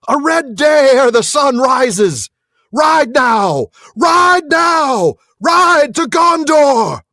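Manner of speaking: high, loud rallying shout to the riders; king shouting his riders to war, building to a high roar; loud battle cry. Acted. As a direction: rouse an army into battle. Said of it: "he cried in a loud voice".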